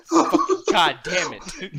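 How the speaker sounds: slow, loud frustrated laugh-shout; loud drawn-out exasperated curse with laughter; laughing outburst. Real, not acted. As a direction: let it rip through a laugh.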